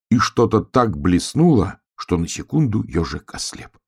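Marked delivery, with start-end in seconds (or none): none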